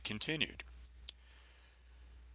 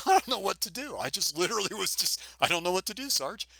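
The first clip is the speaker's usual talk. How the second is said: higher, helpless stammer; flustered rookie; 9 semitones higher, faster than usual. Retold or imitated. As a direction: play your past self lost and pleading. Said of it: "I go".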